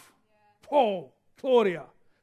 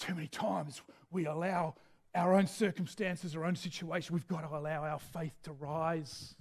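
much softer and lower, intimate; soft exhortation; 9 semitones lower, 13 dB quieter than usual. Real, not acted. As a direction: draw them in quietly.

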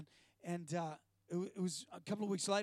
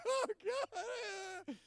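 much higher, crying oh God; weeping oh God; 13 semitones higher than usual. Retold or imitated. Moviegoer weeping at Braveheart's end, crying out high. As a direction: overcome with tears at the film.

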